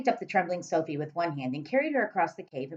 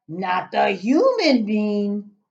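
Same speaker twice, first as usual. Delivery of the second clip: giant voice; 4 semitones higher, 9 dB louder, much slower than usual. Acted.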